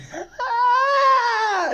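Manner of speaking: loud, high shrieking laugh; burst of laughter. Real, not acted. A streamer cracking up at a chat message with a loud high laugh. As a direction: crack up loudly, high and shrill.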